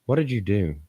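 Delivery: ordinary talk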